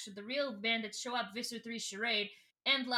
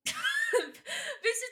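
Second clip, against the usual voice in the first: high, slow laughing through words; can't stop laughing while narrating; 13 semitones higher than usual. Real, not acted. Host cracking up while retelling the trial, laughing.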